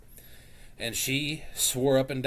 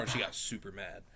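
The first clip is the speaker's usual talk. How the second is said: ordinary talk, nothing marked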